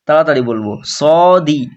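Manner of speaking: ordinary delivery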